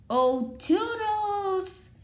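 drawn-out high sing-song call; stretched, high call to a helper. Acted. Calling for Toodles.

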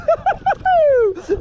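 squealing high-pitched laugh; giddy high laugh. Real, not acted. Fisher laughs at his boast, high squeal. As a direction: crack up with a shriek.

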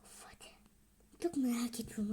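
hushed whisper, eager; excited whisper. Real, not acted. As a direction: whisper it, can't wait.

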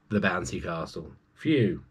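usual speaking voice

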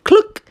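high, loud hen cluck; a sharp high hen cluck. A narrator's reading. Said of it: "the hen said".

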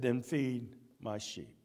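soft, low and slow, a tender command; gentle Jesus voice. Acted. Jesus tenderly charging Peter to care for his lambs. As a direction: lay the charge on him gently.

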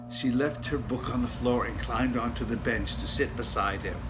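usual speaking voice

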